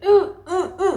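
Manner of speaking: short high mock moans; contraction moans, imitated. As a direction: mimic yelping through a contraction. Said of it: "every time the contractions came".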